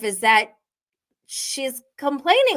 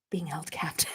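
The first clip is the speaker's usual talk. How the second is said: whisper; 4 semitones lower, 12 dB quieter, faster than usual. Real, not acted. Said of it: "joking aside".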